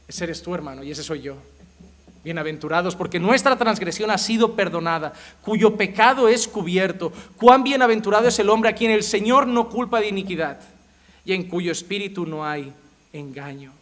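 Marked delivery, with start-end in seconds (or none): 2.2-13.7 solemn scripture reading. Acted. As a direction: let the blessing swell. (lectura solemne de la Escritura, cada vez más fuerte)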